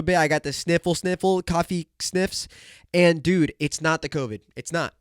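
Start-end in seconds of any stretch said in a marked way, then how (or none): none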